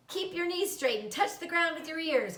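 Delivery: called out. A narrator's reading. Charlotte coaching Wilbur's tricks, calling out. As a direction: shout the instruction across the yard. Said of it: "called Charlotte".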